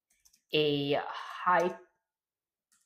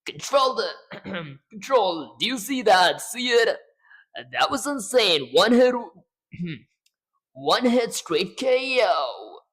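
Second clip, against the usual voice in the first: loud, high hyped radio voice; Shutter Punch voice; 6 semitones higher, 4 dB louder, slower than usual. Acted. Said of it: "the man, the myth, the legend".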